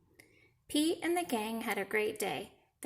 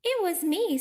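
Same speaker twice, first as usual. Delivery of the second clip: high, louder cheeky confession; Grumpy Toad; 8 semitones higher, 5 dB louder than usual. Acted. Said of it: "said Grumpy Toad".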